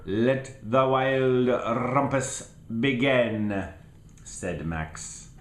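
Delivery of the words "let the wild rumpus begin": slow and louder royal proclamation; Max cries out. Acted. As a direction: proclaim it slowly to the wild things. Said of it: "cried Max".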